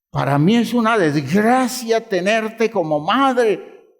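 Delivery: mock indignant child scolding his mother; resentful son. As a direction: throw the blame at mom, rising on each así.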